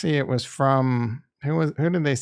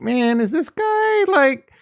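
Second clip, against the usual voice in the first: doubting wonder; 11 semitones higher, slower than usual. Retold or imitated. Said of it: "even he got me to be like".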